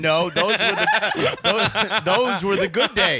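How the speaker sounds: laughing through the words. Real, not acted. Friend laughing while recalling the old days, laughing. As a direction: chuckle while reminiscing.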